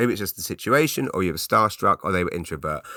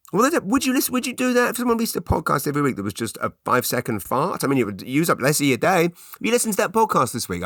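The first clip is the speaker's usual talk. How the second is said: high-pitched, excited, hammed-up listener patter; mock podcast listener; 8 semitones higher than usual. Comedian acting out fans of a fart podcast, high mock enthusiasm. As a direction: play an eager fan, pitched right up. Comic imagined listener bit.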